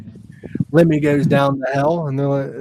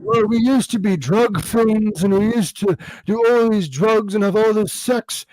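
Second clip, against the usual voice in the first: [name]; 5 semitones higher than usual. Retold or imitated. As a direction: raise the pitch a little and ramble. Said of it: "that famous video when he was".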